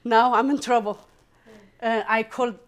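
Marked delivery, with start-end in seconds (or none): 0.0-1.0 alarmed telling. Retold or imitated. Grabbed, alarmed.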